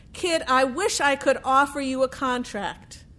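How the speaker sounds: ordinary delivery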